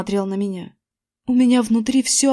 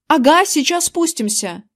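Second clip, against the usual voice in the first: higher-pitched shout downstairs; called out; 5 semitones higher, 3 dB louder than usual. A narrator's reading. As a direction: call back to dad below. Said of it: "крикнул я". (крикнув в ответ)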